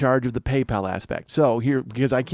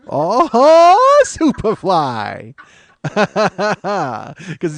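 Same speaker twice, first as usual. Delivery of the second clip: stretched out through laughter; cracking up at his own joke; laughing; 7 semitones higher, 9 dB louder, much slower than usual. Real, not acted.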